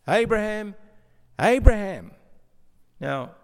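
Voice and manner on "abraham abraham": calling the name out loud and urgent. A narrator's reading. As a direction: call across to him, urgent. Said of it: "the angel of the Lord calls out".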